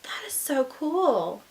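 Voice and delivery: whispered in hushed awe; hushed, amazed whisper; awed whisper. Real, not acted. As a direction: breathe it out, amazed. Awed by the gorgeous note.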